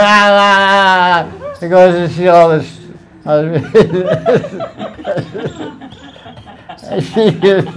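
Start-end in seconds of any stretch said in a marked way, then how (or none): 0.0-1.5 slow drawn-out imitated baby wail; slow mock infant cry; baby crying imitation. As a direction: mimic a crying baby, slowly. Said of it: "she hears the baby crying".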